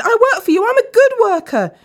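high, loud desperate begging; pleading. A narrator's reading. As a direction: plead to keep your job and your life. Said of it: "Dan pleaded".

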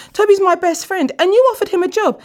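high, loud panicked excuse; pleading. A narrator's reading. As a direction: beg to be forgiven. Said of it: "Dan cried out".

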